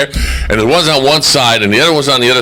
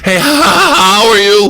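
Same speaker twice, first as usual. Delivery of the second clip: higher, slow, giggly hello; nervous greeting; 10 semitones higher, much slower than usual. Retold or imitated. Host imitating a nervous person saying hi, high and giggling. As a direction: greet someone with nervous laughter. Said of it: "when someone gets nervous".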